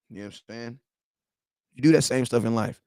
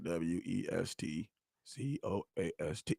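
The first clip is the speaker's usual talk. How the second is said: low, soft, slow letter-by-letter spelling; spelled out; 8 semitones lower, 14 dB quieter than usual. Retold or imitated. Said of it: "I'm the guy that be like".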